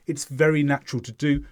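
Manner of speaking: ordinary talk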